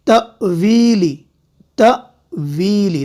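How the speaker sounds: slow तवीली with a glottal catch. Shown as a demonstration. Quran teacher demonstrates the glottal catch to students. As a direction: start तवीली with a clean glottal stop, slowly. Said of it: "ये झटका हुआ".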